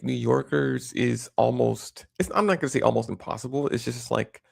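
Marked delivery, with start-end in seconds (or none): none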